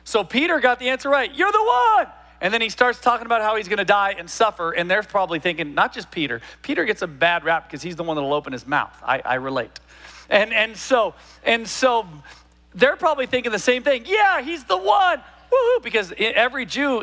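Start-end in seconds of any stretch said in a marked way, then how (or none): 1.3-2.1 high, eager outburst as Peter; excited blurt. Retold or imitated. Peter blurts the right answer. 14.1-15.8 high, slow, whooping cheer; cheering disciples. Retold or imitated. Disciples cheering over the Messiah.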